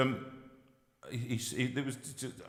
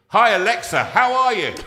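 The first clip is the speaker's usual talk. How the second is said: calling out to Alexa; 7 semitones higher, 10 dB louder than usual. Pretend. Addressing listeners' Alexa devices.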